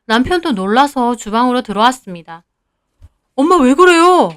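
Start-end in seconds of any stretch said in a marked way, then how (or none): 3.3-4.3 higher-pitched, startled husband; startled protest. A narrator's reading. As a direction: ask in mild alarm what is wrong. Husband startled by mother's outburst.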